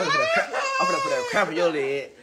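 squeaky high laughing threat. Real, not acted. Joking to the little one, laughing high. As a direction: laugh through the threat, voice jumping up.

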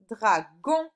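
loud "Dragon" in two beats; syllable by syllable. Shown as a demonstration. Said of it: "le G sur la seconde". (« Dragon » découpé en deux syllabes appuyées)

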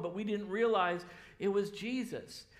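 ordinary talk

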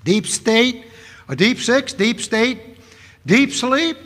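emphatic preaching. Real, not acted. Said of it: "last point".

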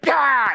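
mock scare shout. Prank email face leaping out at the viewer, mock scare yell. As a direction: bark out a fake fright.

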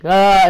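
loud high "ah"; imitated high cry. Singer demonstrating the voice crack, loud high cry. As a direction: let out a loud, high-pitched yelp. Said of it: "high pitch for some reason".

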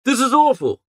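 jolted by the bad news; gentleman's cry of dismay; dismayed exclamation.

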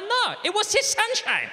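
very high, loud Spanish outburst; Spanish accent. Acted. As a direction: wail the answer in a Spanish accent. Spanish traveller answers, exasperated.

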